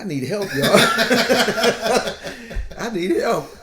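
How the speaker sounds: loud, high laughing plea; laughing confession. Real, not acted. Telling friends he needs help, loud laughing. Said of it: "confessing between laughs".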